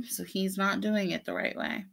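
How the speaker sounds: plain reading voice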